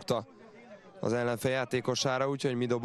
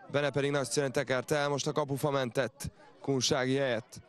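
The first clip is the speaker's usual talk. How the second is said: ordinary talk, nothing marked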